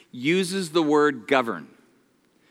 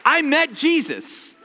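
high, slow, laughing "I met Jesus"; excited; 9 semitones higher, slower than usual. Real, not acted. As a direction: gush about meeting him. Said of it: "It was so fun".

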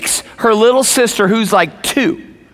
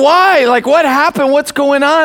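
retold alarm, higher-pitched urgent questions; alarmed questioning; 7 semitones higher than usual. Husband answers his crying wife's call, alarmed questioning. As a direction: re-enact a worried husband's startled reaction.

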